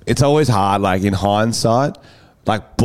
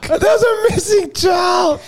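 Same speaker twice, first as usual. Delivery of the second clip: slow, very high incredulous cry; mock shout; slower than usual. Co-host reacts to the missing child story, mock shout. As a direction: shriek it in mock disbelief. Mock echo of store announcement.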